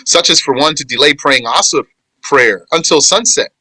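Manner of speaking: ordinary talk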